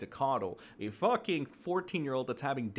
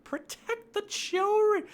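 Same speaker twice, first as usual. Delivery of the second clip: much higher, mocking whine; mocking whiny voice; 7 dB louder than usual. Mocking people who shield doxers, in a high whiny voice. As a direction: sneer it like a pearl-clutching scold. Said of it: "like, the whole".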